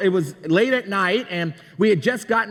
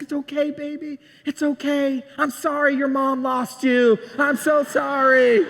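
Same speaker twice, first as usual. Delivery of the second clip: acted tearful-parent reassurance, pitched up; soothing apology; 4 semitones higher than usual. Dad holds his found little girl, soothing.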